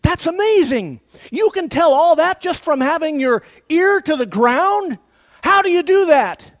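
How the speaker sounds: high, loud astonished exclamation; the cowboy, stunned, asks the brave how he did it; amazed cowboy. Retold or imitated. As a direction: marvel at the brave's skill. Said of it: "he looks down at the Indian and he says".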